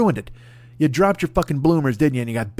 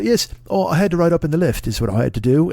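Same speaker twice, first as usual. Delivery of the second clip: put-on posh English accent; 3 semitones higher than usual. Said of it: "And you go".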